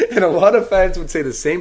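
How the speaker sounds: high laugh spilling into the words. Real, not acted. Fan admitting he'd cheer the return, laughing. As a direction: laugh through the admission.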